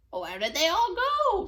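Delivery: loud, high witch shout. Acted. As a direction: call out across the farm. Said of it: "Gretch shouted".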